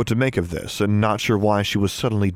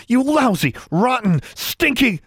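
high, punchy, outraged name-calling; sputtering insults; 13 semitones higher, slower than usual. A narrator's reading. Girl hurling names at him, sputtering high with outrage. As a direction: spit each word out high, one by one. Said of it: "sputtered along with a few stronger words".

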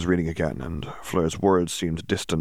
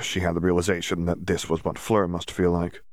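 usual speaking voice

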